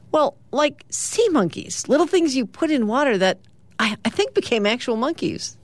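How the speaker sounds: high-pitched Rat reminiscing about toys; comic-strip Rat. Acted. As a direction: pitch it up for an eager cartoon rat. Rat recalls cool childhood toys.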